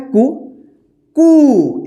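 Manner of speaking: कु said slowly and clearly as a model syllable; कु pronounced distinctly as a model. Shown as a demonstration. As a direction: say कु clearly for the class to repeat. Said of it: "इसमें बड़ी ओ की मात्रा लगा है".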